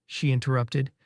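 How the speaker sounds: ordinary delivery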